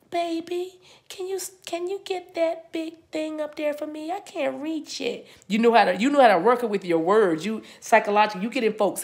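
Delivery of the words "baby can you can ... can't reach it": put-on high, soft, coaxing baby voice; sweet-talking lady. A woman sweet-talks her man into helping her, high and soft. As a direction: coax your man high and soft to fetch it. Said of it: "Some of you ladies could use your".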